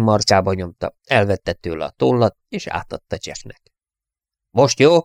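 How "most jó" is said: sharp, loud and high retort; snapping. A narrator's reading. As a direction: snap it back in irritation. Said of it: "csattant föl". (ingerült, hangos csattanás)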